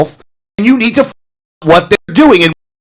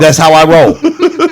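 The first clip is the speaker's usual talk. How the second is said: slow, drawn-out swagger; swaggering catchphrase; much slower than usual. Pretend. Echoing a cohost's line back, slow playful swagger. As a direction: savour it like a catchphrase.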